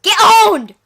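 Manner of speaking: loud gloating taunt; gloating shout. Real, not acted. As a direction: shout it to rub it in. Taunting after a kill.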